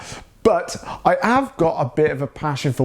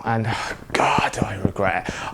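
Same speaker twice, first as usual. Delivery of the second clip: breathy whispered sigh of regret, much softer; a real, rueful whisper to himself; 5 semitones lower, 3 dB quieter than usual. Real, not acted. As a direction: let it out on a sigh, barely voiced. Confessing regret about not travelling.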